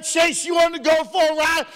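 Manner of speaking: high excited call to a dog. Retold or imitated. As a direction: rile up the dog with a high shout. Excitedly calls his dog.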